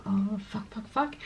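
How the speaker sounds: soft panicked swearing under the breath; retelling office stress as a soft muttered oh fuck. Retold or imitated. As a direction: swear quietly to yourself, overwhelmed. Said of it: "je me sentis un peu genre".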